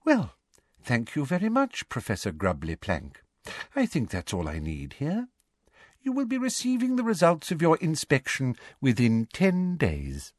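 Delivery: high, prim and sugary; a saccharine, simpering official; high, prissy inspector dismissing the teacher; Umbridge voice. Acted. Umbridge voice in story.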